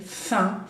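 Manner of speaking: faim with a nasal vowel, the m silent. Shown as a demonstration. Teacher modelling faim for Spanish speakers, nasal vowel. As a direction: say faim through the nose with no final m. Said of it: "faim, bien nasal".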